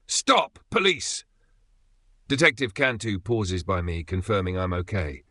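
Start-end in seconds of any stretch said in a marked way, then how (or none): 0.1-1.2 high, loud commanding yell; police yell. A narrator's reading. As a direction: bark it at the fleeing suspect.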